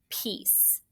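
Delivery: the word Peace fully whispered, no voice. Shown as a demonstration. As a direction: breathe Peace out as a calm whisper. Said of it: "do you remember that signal".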